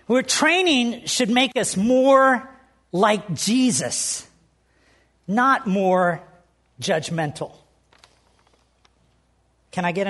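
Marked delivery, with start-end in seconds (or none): 0.1-7.5 slow emphatic point. Acted. First slow statement of point.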